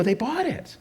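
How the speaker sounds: high-pitched contained glee; mock excitement. Writer celebrates after leaving the meeting.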